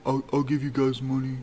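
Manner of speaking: put-on high mumbling voice; imitated mumble. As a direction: mimic him mumbling a reluctant offer. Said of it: "he mumbled".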